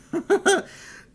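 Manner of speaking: high nervous laugh; relieved laugh. Real, not acted. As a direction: laugh off the fear. Laugh after insertion.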